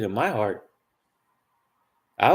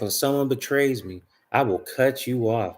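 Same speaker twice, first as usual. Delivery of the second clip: ordinary talk, nothing marked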